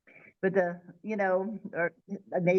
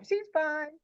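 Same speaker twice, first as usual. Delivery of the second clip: lightly voiced child, higher pitched; carefree kid; 8 semitones higher than usual. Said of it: "like a kid, you know, would say, thinking nothing about it".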